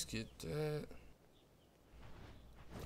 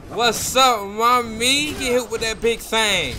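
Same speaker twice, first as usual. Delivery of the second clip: high, loud, cocky call; loud taunt; 6 semitones higher, 7 dB louder, slower than usual. Real, not acted. Gamer landing a hit on an opponent, taunting loudly. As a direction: crow it at the opponent.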